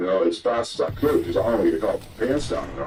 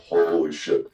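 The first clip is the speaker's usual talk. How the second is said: ordinary talk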